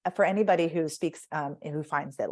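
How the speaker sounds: ordinary delivery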